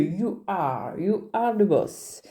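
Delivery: "You are the boss" slow, in a put-on English accent. Shown as a demonstration. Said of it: "Avec l'accent, c'est mieux".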